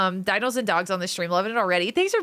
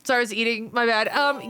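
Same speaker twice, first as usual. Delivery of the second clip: normal talking voice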